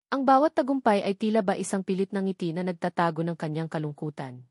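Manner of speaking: ordinary delivery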